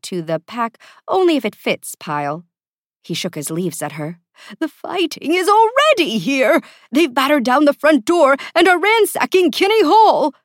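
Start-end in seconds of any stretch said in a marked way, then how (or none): 4.6-10.3 high, loud, frantic alarm; anxious assistant raising the alarm; panicked. A narrator's reading. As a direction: cry out the bad news in panic. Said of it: "the most anxiety-prone".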